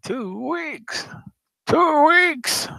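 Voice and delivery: high, shrill squeal; shrieking. Acted. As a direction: squeal it in mock despair. Repeats the shriek.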